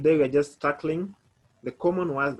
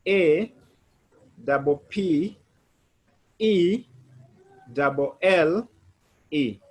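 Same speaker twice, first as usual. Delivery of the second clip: spelling again louder; 3 semitones higher, 3 dB louder, much slower than usual. Shown as a demonstration. Teacher spelling again to check everyone follows. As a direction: drill each letter for the class. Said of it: "Is everyone on board?".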